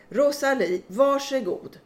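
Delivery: loud, sharp call of an impatient name; irritated call. Retold or imitated. Nurse calling a patient from the booth, loud and irritated. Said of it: "ännu en gång irriterat säger".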